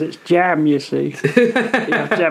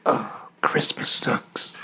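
whispering; 8 dB quieter, much slower than usual. Real, not acted. Whispered aside amid laughter.

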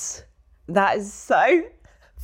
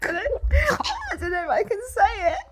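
high, slow, breaking with laughter; crying with laughter, barely getting it out; laughing to tears; 12 semitones higher, slower than usual. Real, not acted. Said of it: "I'm crying".

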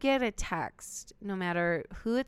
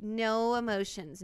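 usual speaking voice

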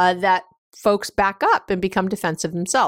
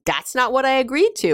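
higher-pitched imitation of a protesting partner; retold defensive protest; 6 semitones higher than usual. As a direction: mimic a quick defensive objection, pitched up. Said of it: "your partner immediately is gonna go".

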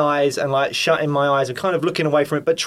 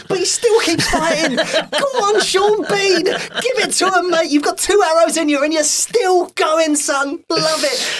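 high, excited laughing cheer; 12 semitones higher, 3 dB louder than usual. Retold or imitated. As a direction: egg the fighter on, grinning. Cheering on Boromir in retelling.

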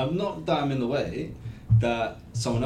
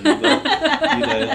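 laughing; 12 dB louder, much slower than usual. Real, not acted. Laughing while telling.

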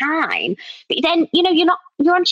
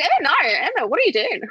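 mock-dismayed self-scolding with an Australian twang; imitated Australian accent, swooping up and down; 4 semitones higher than usual. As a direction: tell yourself off in a put-on Aussie accent, about to laugh. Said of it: "when I was in Australia".

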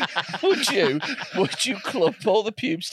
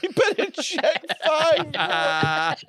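high slow laughing repeat; 12 semitones higher, much slower than usual. Acted.

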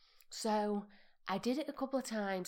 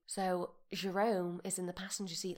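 ordinary delivery

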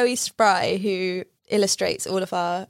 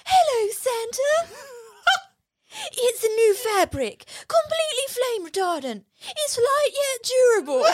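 squeaky elf through laughter; silly British elf; 12 semitones higher than usual. Retold or imitated. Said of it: "He's like".

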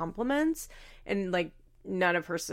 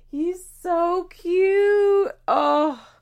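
gushing; 11 semitones higher, 8 dB louder, much slower than usual. Real, not acted. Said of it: "gushing over the child".

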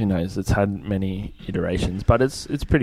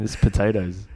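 mock Irish accent. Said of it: "I think I can do an Irish accent".

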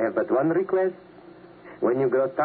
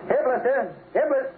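calling dog; 12 semitones higher than usual. Acted.

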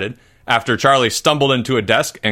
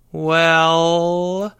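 long drawn-out, higher "well"; hesitant; 6 semitones higher than usual. A narrator's reading. Charlie begins his account to the teacher, hesitant. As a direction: stretch it out while gathering your words.

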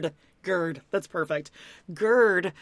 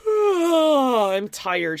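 mock weary sigh; 6 semitones higher, 6 dB louder, much slower than usual. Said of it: "Danielle's gonna, like".

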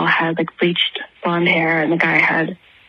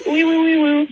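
panicked siren sound effect; mock siren wee woo; siren noise; 9 semitones higher than usual. Real, not acted. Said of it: "I sort of panicked at the end".

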